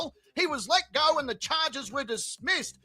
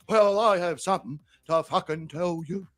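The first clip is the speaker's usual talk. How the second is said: imitating Terry; 7 semitones lower than usual. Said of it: "He goes".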